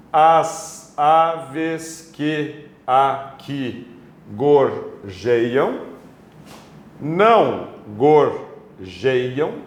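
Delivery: very slow, each syllable set apart by pauses; drawn-out syllable-by-syllable verse reading. Shown as a demonstration. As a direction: split every word into separate syllables, like dictation. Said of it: "primeiro passo, separação silábica normal".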